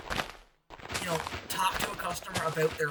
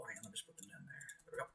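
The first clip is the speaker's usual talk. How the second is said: aside whisper; 9 semitones lower, 19 dB quieter, much faster than usual. Real, not acted. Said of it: "aside while setting things down".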